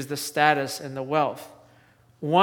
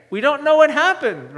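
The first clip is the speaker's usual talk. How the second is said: ordinary delivery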